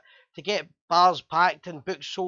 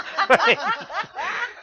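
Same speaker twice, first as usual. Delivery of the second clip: a loud high burst of laughter; big laugh; 6 dB louder than usual. Real, not acted. Host laughing at his own drunken weekend, loud burst of laughter.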